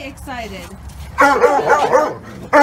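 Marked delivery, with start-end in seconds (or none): 1.2-2.6 sharp loud calls of hey to stop the dog. Real, not acted. Owner shouting hey at overexcited dog, loud. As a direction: bark out hey hey to break the dog's focus.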